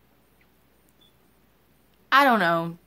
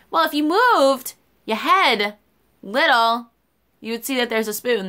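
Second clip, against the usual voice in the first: exasperated retort; 4 semitones higher, 4 dB louder than usual. Retold or imitated. Waitress venting at a rude customer, heated and higher. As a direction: let your annoyance boil over.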